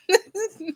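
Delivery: short high-pitched giggle. Real, not acted. Laughs at own yawning.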